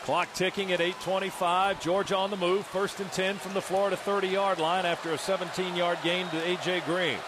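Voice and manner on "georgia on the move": ordinary delivery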